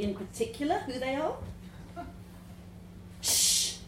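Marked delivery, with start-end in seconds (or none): 3.2-3.8 sharp hushing shh; urgent shush. A narrator's reading. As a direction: hush them quickly. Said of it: "the voice answers".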